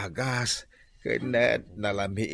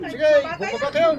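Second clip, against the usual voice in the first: much higher, louder and fast; fast shouted acceptance; angry shout; 8 dB louder, faster than usual. Acted. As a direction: snap back ready to fight. Driver accepts fight, shouting.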